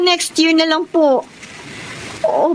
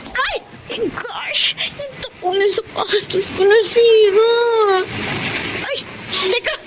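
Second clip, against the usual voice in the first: dismayed cry; 9 semitones higher than usual. Acted. Shoe strap breaks mid-road.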